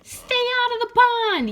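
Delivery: high, loud frog yell. Acted. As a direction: shout it from the island. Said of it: "yelled Milton".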